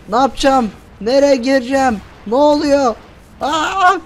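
lost and scrambling for cover; panicked gamer. Real, not acted. Player trapped under rocket fire asks frantically where to go.

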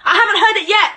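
loud, high-pitched challenge; angry confrontation. Real, not acted. Confronting ex about their son.

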